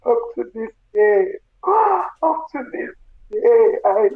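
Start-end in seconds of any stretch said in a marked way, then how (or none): 1.6-3.8 gasping, slow, higher and cracking; the words break apart in a real sob; breaking into tears. Real, not acted. Grieving mother to listeners, voice breaking into real tears.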